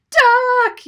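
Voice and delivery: high, full-force warning shout; yell. Acted. Rosie warns as Gizmo lands on the contraption, yelling. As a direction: yell it suddenly, very high and loud. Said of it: "yelled Rosie".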